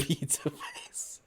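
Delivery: slow, whispered through a laugh. Real, not acted. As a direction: whisper it while giggling.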